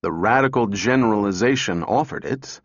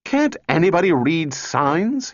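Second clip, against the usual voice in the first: yell; 6 semitones higher than usual. A narrator's reading. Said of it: "he yelled".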